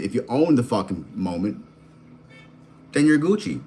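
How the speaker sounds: usual speaking voice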